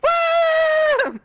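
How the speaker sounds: high whooping cheer; excited cheer. Real, not acted. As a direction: whoop with delight. Cheering at gold total.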